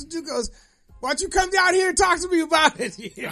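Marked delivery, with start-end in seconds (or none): 1.0-3.0 higher, louder, cocky dare; taunting challenge. Retold or imitated. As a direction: throw the dare right back at him. Fireworks guy challenges neighbor.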